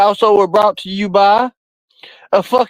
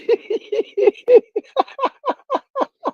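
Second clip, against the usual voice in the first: long high-pitched cackle; helpless laughing fit, high and long; long squealing laugh; 4 dB quieter than usual. Real, not acted. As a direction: let a long shrieking laugh roll. Streamer cracking up at chat.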